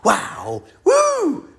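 hammed-up hype; hyped-up imitation. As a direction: imitate an overexcited young guy. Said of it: "he's all pumped up and hyped up".

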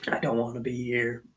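ordinary delivery

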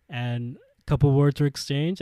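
normal talking voice

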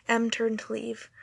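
ordinary delivery

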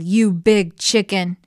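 loud, slow taunt; yelling. A narrator's reading. Henrietta taunts the hidden egg napper, loud drawn-out yell. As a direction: throw the insult out forcefully. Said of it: "she yelled".

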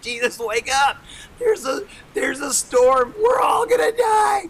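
high, loud cry of mounting panic; urgent yell climbing to a frantic scream; panicked shouting. Acted. Peter wakes Jesus in the storm, panicked shout.

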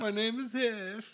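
slow, high dumb-guy voice; drawn-out doofus impression; Channing Tatum voice. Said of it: "Channing Tatum in Twenty-two Jump Street".

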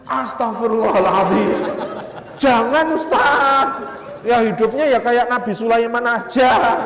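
a mother horrified at the thought, pleading loudly; startled mother begging the preacher; shocked refusal. Retold or imitated. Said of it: "langsung terbelalak".

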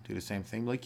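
ordinary talk, nothing marked

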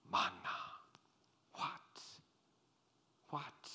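dazed, near-whispered amazement; hushed bewilderment. Acted. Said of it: "Amazement, perplexity, bewilderment".

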